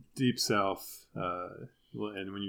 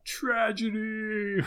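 high, drawn-out mock lament; John Piper; 12 semitones higher, 3 dB louder, much slower than usual. Answering the lady's question as the preacher, in a high mock wail. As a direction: stretch it out high, hammed-up and nearly sung. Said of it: "someone asking John Piper that question".

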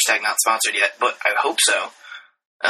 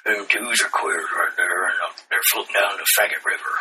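put-on deep Southern accent; 3 semitones lower, 3 dB quieter than usual. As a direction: take over the friend's Southern impression. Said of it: "we know a guy who's got a deep accent like that".

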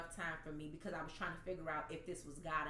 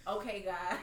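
laughing through emotion; 2 semitones higher than usual. Real, not acted. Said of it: "man, I am getting emotional".

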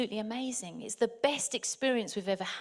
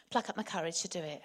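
ordinary delivery

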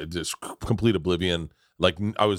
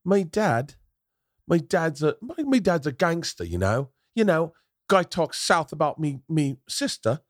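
higher-pitched imitation Cockney; London gangster accent; 8 semitones higher than usual. Comedian imitates dude bragging about gangster dad, mock Cockney accent. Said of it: "I can't do the accent".

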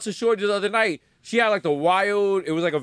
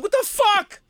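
high-pitched mock outburst of frustration; frustrated; 13 semitones higher, slower than usual. Frustrated customer at hell's Metro card machine, mock exasperation. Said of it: "you frustrated and shit".

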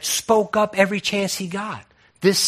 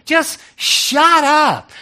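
retold shout; 10 semitones higher, 7 dB louder, much slower than usual. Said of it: "he said".

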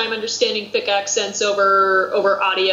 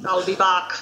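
Arnold Schwarzenegger impression. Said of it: "it turns Austrian really quickly".

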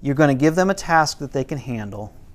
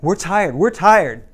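put-on eager kids; 4 semitones higher, 6 dB louder, slower than usual. Kids eager to escape chores.

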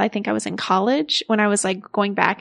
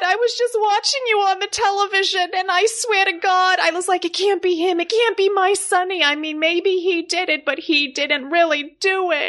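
higher, shocked, fretful wife on the phone; Angie on the phone; 10 semitones higher than usual. Acted. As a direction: play the frantic wife who just saw him on TV.

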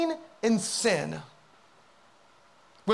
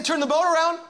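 raised, high command; insistent high-pitched order; urgent call; 7 semitones higher, 4 dB louder, faster than usual. Acted. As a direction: call out the obvious fix. Preacher acts what Jonah should say.

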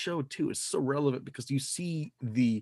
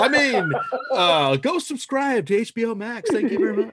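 high, laughing promo pitch; laughing mock plug; 9 semitones higher, 4 dB louder than usual. Host jokingly plugs subscribing to HBO Max, laughing mock plug. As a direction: pitch it loudly through the giggles.

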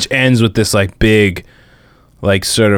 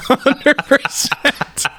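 playful; 10 semitones higher, much slower than usual. Pretend. Jokingly admits being twelve.

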